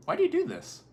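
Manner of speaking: very high-pitched mock kid voice; imitated child's incredulous question, way up high; baffled child friend. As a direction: squeak it like a puzzled little kid.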